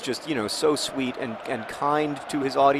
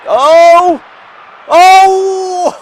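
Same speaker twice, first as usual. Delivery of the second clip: high, very loud "oh" breaking into laughter; huge high-pitched cry of disbelief; anguished outburst; 15 dB louder than usual. Real, not acted. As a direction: wail at a missed chance. Reacts to a missed chance.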